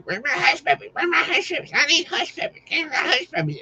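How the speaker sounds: very high, impatient mock yell of demanding waitresses; mock waitress holler. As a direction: squeal it like a pushy waitress wanting her order. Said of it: "waitresses would sit there and holler at you".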